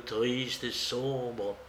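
plain reading voice

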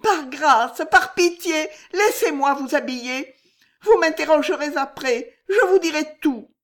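very high, loud frantic begging; desperate pleading. Acted. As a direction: beg her to hurry. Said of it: "regard désespéré".

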